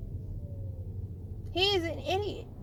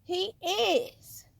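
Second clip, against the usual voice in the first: mocking; much slower than usual. Shown as a demonstration. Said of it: "I'm showing you how ridiculous".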